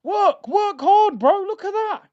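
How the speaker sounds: high, loud exclamations at the players; exasperated outburst. Real, not acted. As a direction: cry out in high-pitched disbelief at lazy play. Exasperated yelling at players on pitch.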